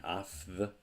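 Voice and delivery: afth with an f before th; voiceless afth. Shown as a demonstration. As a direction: say afth, hissy f into th. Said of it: "It's harder to say aft".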